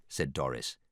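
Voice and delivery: plain reading voice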